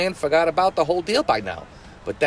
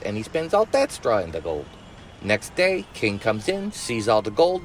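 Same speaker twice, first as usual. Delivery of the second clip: ordinary talk, nothing marked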